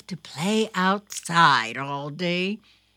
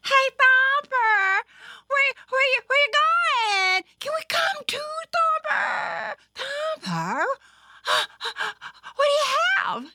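put-on very high, excited kid voice; pestering little sisters; 4 dB louder, faster than usual. A narrator's reading. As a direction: tug at a big brother with question after question.

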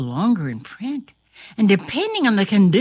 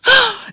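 sharp gasp; 12 semitones higher, 5 dB louder than usual. Acted. Spell undone, Caleb human again.

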